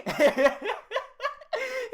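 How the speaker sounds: loud, high cackle; high burst of laughter. Real, not acted. Streamer laughs loudly at the rival's comment. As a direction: break into a big laugh.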